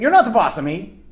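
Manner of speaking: put-on childish defiance echoed; defiant child. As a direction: snap it back like a sulky kid. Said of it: "isn't he saying basically the same thing".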